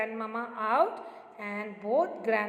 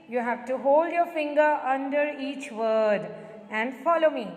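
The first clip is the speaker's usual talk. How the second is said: usual speaking voice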